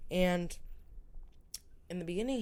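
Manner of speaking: normal talking voice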